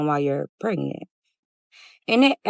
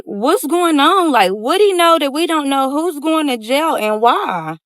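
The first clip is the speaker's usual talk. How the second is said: alarmed; 7 semitones higher, 4 dB louder than usual. Retold or imitated. Alarmed, demanding answers from friend.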